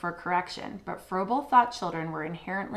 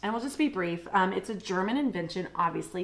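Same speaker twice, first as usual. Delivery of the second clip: normal talking voice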